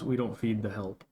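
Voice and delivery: low, quiet disdainful butler; cold butler. Acted. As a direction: look them in the eye and refuse, coldly. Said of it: "looks at you straight in the face and says".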